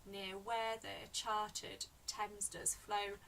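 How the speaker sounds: "Near where the charted Thames does flow" slowly, beat by beat; slow, even syllables on "Near where the charted Thames does flow"; counting syllables. Shown as a demonstration. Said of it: "eight syllables again".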